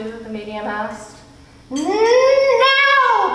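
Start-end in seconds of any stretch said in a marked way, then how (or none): 1.7-3.3 high, loud, ringing sung order; command. A narrator's reading. Mrs Which ordering the medium to show Earth, in a high, loud, sung command. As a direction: hold the word out like a summons. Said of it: "Missus Witch commanded".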